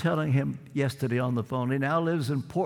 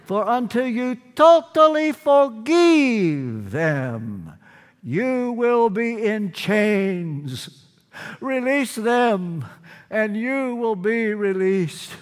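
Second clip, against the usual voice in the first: put-on slow, higher, drawn-out Romanian accent; 4 semitones higher, 5 dB louder, slower than usual. Friend warns him he will be in chains, mimicked Romanian accent. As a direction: intone it solemnly in an imitated Romanian accent, very slowly. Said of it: "in his Romanian accent".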